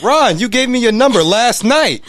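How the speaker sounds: high, loud mock voice; imitating Ron. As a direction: act out the eager caller. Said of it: "he goes".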